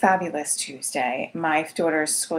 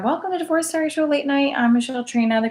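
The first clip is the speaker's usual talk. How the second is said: higher, fast, bright host welcome; upbeat host intro; 7 semitones higher, faster than usual. Real, not acted. Host restarting her show intro, higher and fast. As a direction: kick off the show with pumped-up energy.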